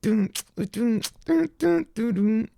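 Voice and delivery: high sung dun-dun riff, bouncing; hummed guitar riff. Shown as a demonstration. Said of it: "it has been doing this little riff".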